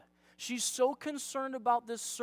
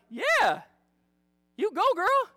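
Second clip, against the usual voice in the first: high, loud, sassy encouragement; acted rooting, punched up high; cheering her on; 6 semitones higher, 6 dB louder than usual. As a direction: whoop it like an eager supporter. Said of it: "I'm like".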